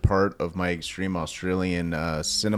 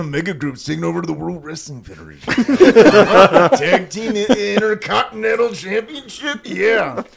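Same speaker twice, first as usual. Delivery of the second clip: loud, high Macho Man promo; wrestling promo; 6 semitones higher, 8 dB louder than usual. Acted. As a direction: cut a wild wrestling promo. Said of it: "say the same thing as in the wrestler voice".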